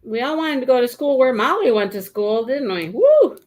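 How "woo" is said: loud, high whoop. Real, not acted. Joking about wanting Molly's school, whooping. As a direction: let out a big high "woo".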